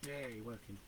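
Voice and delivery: ordinary talk, nothing marked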